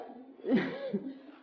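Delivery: short real laugh, pitched high; laughter. Real, not acted. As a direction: laugh mid-explanation, high and light. Still amused while explaining rawness.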